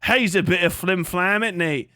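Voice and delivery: hammed-up British slang impression; exaggerated British banter; mock British accent. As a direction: do a silly Brit insulting someone. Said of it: "Just say whatever British shit".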